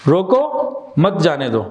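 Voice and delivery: slow, with a clear stop between روکو and مت; pause after روکو. Shown as a demonstration. Teacher quizzing on the stop, pausing after روکو. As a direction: say روکو, stop, then مت جانے دو. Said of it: "یہاں پہ stop کہاں لگایا ہم نے".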